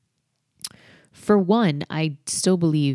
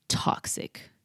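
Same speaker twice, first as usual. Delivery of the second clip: breathy whisper on toxic; 5 dB quieter than usual. Real, not acted. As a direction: drop to a whisper. Whispered word for effect.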